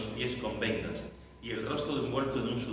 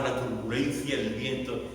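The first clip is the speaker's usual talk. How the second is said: normal talking voice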